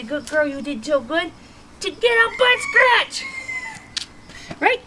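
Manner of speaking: reward the dog with your voice; high praise. Real, not acted.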